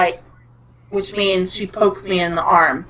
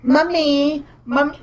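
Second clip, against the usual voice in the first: put-on higher little-child call; imitated toddler; 6 semitones higher than usual. Toddler daughter calls for her mother, higher imitated voice. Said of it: "a toddler, she used to call me Mummy".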